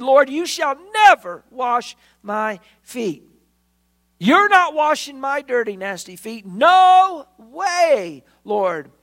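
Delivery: loud, slow, high refusal, leaning on never; hammed-up outrage at the Lord; indignant protest. Peter loudly refusing to let Jesus wash his feet, indignant.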